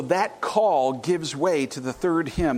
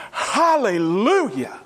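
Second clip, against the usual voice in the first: drawn-out loud hallelujah; 5 semitones higher, 5 dB louder, slower than usual. Acted. Congregation crying with elders.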